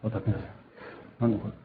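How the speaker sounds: quiet frustrated muttering under his breath; muttered aside. Real, not acted. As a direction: grumble to yourself, barely audible. Demo fails, mutters to himself.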